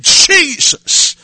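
high ringing cry of the name; calling out Jesus. Retold or imitated. Preacher's friend facing the medicine man, crying out the name loud.

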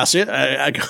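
ordinary talk

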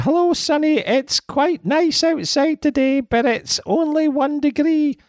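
put-on high-pitched, slow Scottish old lady; Scottish mother on the phone, high and slow; Scottish mother impression. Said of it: "That was probably quite a good Scottish accent".

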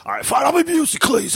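high shouted half-hearted Busey; a quick yelped stab at Busey; mock Busey voice. Cohost offers to be Busey, high mock shout. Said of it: "you don't have enough energy for Busey".

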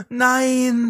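high gasp then a high shocked "Nein"; gasp, then cry no in disbelief. Real, not acted. Hearing a friend's awkward greeting story, high shocked gasp.

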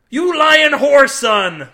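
high, loud, drawn-out insult; enraged shout. A narrator's reading. Naked man to the accused Cade, furious. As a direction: hurl it at a liar.

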